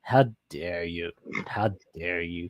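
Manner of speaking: a light imitation of the buddy's outraged line; mock Steven catchphrase. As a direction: drag out the catchphrase like the original. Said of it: "the discussion where he goes".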